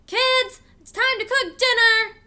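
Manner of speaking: high, loud call up the stairs; father calling the kids down to cook, loud; hollering. A narrator's reading.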